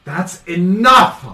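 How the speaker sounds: loud, high, drawn-out command; frustrated outburst. Acted. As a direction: cut everyone off at full voice, exasperated. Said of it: "a lot of frustration".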